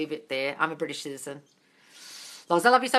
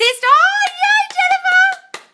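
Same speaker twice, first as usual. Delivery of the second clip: ecstatic cheer; 17 dB louder, much slower than usual. Real, not acted. Cheering a viewer's success.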